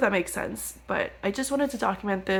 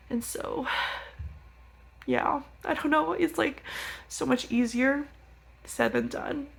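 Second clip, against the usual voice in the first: tearful; 6 semitones higher, 3 dB quieter than usual. Real, not acted. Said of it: "Sorry, I'm, like, crying".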